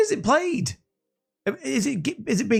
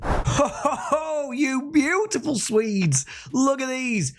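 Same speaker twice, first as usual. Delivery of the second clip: high laughing ho ho, slowing down; delighted laugh through the words; 7 semitones higher, slower than usual. Real, not acted. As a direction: chuckle with glee at the players.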